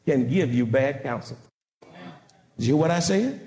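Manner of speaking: ordinary talk, nothing marked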